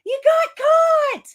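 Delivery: imitated scream. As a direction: shriek it like the guy bouncing through the store. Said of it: "He's screaming".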